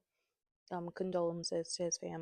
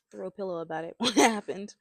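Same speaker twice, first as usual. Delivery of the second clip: laughing; 2 semitones higher, 11 dB louder, faster than usual. Real, not acted. Laughing while telling mishap.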